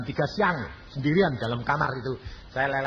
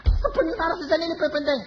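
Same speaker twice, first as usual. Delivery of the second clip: high, pleading fatherly insistence; urging father voice, pitched well up; insisting father. Retold or imitated. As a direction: push the son to come.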